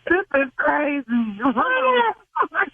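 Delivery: high, slow, tearful laughter; laugh-crying after the prank is revealed. Real, not acted. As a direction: relief spilling out as laugh-cries. Prank revealed, caller breaks up.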